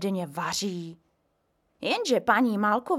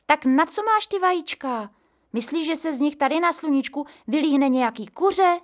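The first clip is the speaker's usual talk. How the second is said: put-on eager child; 7 semitones higher, 5 dB louder than usual. A narrator's reading. Boy pesters grandpa.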